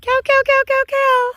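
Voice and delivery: high, rapid repeated cattle call; calling cows. Real, not acted. Calling cattle over a fence.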